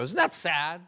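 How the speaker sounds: ordinary talk, nothing marked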